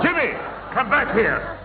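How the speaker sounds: shout. Real, not acted. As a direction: grandad shouting after Jimmy who runs off. Grandad chasing Jimmy.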